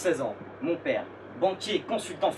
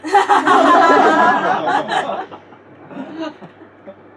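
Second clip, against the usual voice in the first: big audience laugh; crowd laughter; 13 semitones higher, 14 dB louder than usual. Real, not acted. Audience reacts to punchline.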